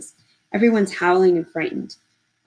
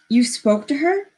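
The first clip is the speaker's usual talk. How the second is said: alarmed; 8 semitones higher, 4 dB louder than usual. Acted. Simeon alarmed at news.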